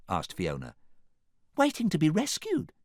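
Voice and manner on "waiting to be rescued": high princess voice; Sleeping Beauty. A narrator's reading. Sleeping Beauty explains she awaits rescue, high-pitched. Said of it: "explained Sleeping Beauty".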